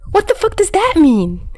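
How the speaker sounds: high, frantic, disbelieving question; frantic confusion. Acted. Dazai alone after the kiss, high frantic confusion. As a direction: demand it of yourself in a panic. Dazai panicking in his room.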